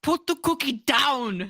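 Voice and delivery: loud, slow Arnold Schwarzenegger bark; slow, loud Arnold movie line; Arnold impression. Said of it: "You wanna know mine?".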